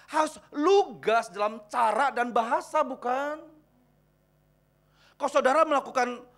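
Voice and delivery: loud, high-pitched exhortation to the congregation; full-force preaching. Real, not acted. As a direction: preach it loud, stretching the key words.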